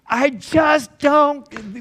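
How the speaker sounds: stuck math pupil, fed up and exasperated. Retold or imitated. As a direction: let the frustration drag out of you. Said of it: "we can get that exasperation in Philip's voice".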